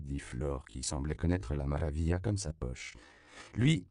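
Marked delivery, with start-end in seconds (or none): 3.5-3.9 high, loud punched word; emphatic. A narrator's reading. Flore singles out the lone lion, emphatic. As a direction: point him out with a jab.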